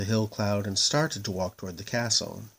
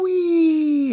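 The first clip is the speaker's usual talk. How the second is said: joyful whee; 10 dB louder than usual. A narrator's reading. Moon rolls away delighted.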